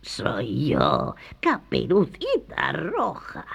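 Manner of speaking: put-on wolf putting on a girl's voice; slow, fake sweet little voice; faked little voice. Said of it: "con una vocecita fingida". (vocecita fingida del lobo)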